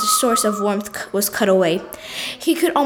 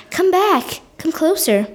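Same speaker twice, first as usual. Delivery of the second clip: drawling shadow; 7 semitones higher than usual. Acted. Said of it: "the shadow drawled".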